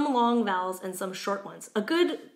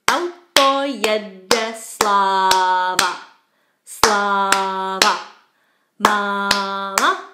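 loud, slow Czech chant, syllable by syllable; clapped nursery rhyme; 7 dB louder, much slower than usual. Shown as a demonstration. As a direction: beat out the rhyme, stretching long vowels. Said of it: "if we do it with claps".

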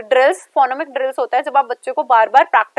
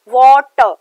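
Water said loud and clear, syllable by syllable; careful example word; 8 dB louder, much slower than usual. Shown as a demonstration. Teacher sounding out water clearly for students. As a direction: spell out water loudly for the class. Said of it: "four phonemes".

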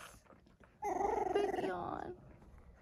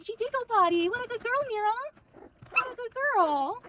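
high, loud, delighted praise; excited puppy praise; 12 semitones higher, 10 dB louder, faster than usual. Real, not acted. Owner praising a puppy for going potty, excited. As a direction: gush loudly in a high pitch.

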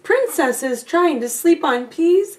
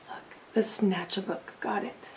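soft aside; 5 semitones lower, 12 dB quieter than usual. Real, not acted. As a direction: lean in and point it out quietly. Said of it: "aside to children".

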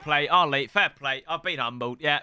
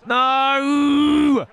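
long, high, loud wail of no; shouted no; 7 semitones higher, 6 dB louder than usual. Real, not acted. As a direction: stretch it out in a big yell. Reacting to play in game.

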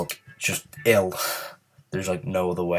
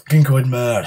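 higher, louder angry outburst; rage-quit frustration; getting mad; 5 semitones higher, 7 dB louder than usual. Real, not acted. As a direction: let the anger burst out. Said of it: "I'm getting quite angry".

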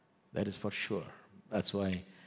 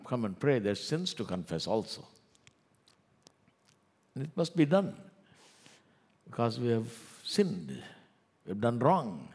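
ordinary delivery

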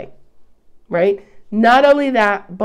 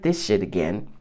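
much lower, softer, fed-up sigh; weary angels; 14 semitones lower, 6 dB quieter than usual. Retold or imitated. Angels tired of impatient humans, low and weary. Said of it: "Angels are like".

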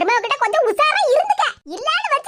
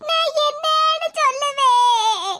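slow, tearful lament; slow weepy "என்னன்னு" with a catch in the voice; sobbing; much slower than usual. Acted. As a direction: drag it out as if sobbing. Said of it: "கண்ணீரை விட்டு கதறுத".

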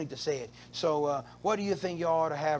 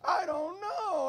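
much higher, slow hammed-up sob; mock crying wife; 11 semitones higher, slower than usual. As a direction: wail it like his tearful wife. Wife crying, voiced in story.

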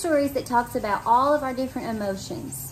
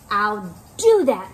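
louder, slower screech; 5 dB louder, slower than usual. Acted. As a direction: yell it out crossly. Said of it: "screeched the grouchy ladybug".

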